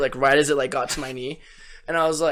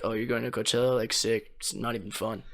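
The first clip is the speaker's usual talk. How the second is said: mock dismissive; 5 semitones lower, 10 dB quieter than usual. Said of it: "so many people are like".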